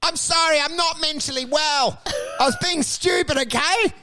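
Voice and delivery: high, desperate pleading. Retold or imitated. Beaten man on the ground begging the old guy, high pleading. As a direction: beg him to stop, voice up high. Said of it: "I'm on the ground going".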